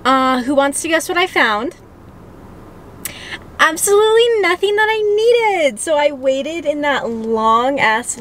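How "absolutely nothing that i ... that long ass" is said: high exasperated burst. Real, not acted. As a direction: burst out, fed up. Exasperated reveal.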